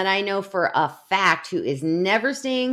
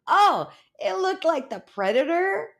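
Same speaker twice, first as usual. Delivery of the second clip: mock casual reply; 10 semitones higher than usual. Imitating Amber answering on the call, mock casual reply. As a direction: imitate a friend amused by the absurd, pitch up. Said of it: "And she's like".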